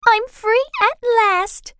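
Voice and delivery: high, slow, light fairy voice; tiny silvery fairy voice. A narrator's reading. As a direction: sigh with relief in a tiny fairy voice. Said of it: "in a tiny silvery voice".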